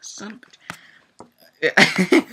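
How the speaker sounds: high, drawn-out laughing curse; exasperated curse. Real, not acted. Vlogger cursing at Oscar, high and very slow. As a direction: let the curse stretch out, half laughing.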